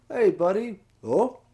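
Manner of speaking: eager NPC hailing his buddy; Gorko voice. Acted. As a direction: boom out a cheery hello to a pal. Player voicing Gorko's dialogue.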